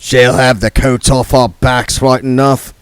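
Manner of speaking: mock complaint to a mark; gambler's voice. Third man voiced.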